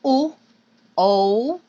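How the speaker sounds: long rounded "o" with lips pushed out. Shown as a demonstration. Teacher demonstrating a back vowel, lips rounded. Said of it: "these back vowels".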